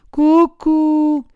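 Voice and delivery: high sing-song cuckoo jeer; mocking taunt. A narrator's reading. Puppet teasing the boys who threaten him, mocking. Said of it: "in segno di canzonatura".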